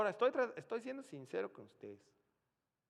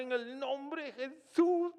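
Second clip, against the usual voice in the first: much higher and louder, slow and pleading; loud fervent prayer; 11 semitones higher, 10 dB louder, slower than usual. Retold or imitated. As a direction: cry out to God for a miracle. Shouted prayer to raise the dead. (oración a gritos, alta y suplicante)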